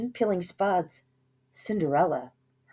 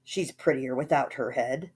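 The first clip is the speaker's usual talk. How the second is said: smug remark; 5 semitones lower than usual. A narrator's reading. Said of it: "He smiled and said".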